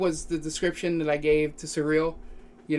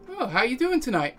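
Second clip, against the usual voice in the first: higher-pitched half-elf desk clerk; mystery voice; 9 semitones higher than usual. Acted. Half-elf clerk greeting arriving guests, higher mystery voice. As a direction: swing the greeting up high, in character. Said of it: "you recognize this voice".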